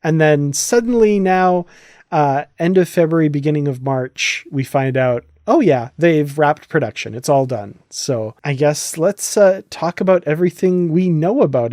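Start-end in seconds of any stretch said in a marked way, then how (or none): none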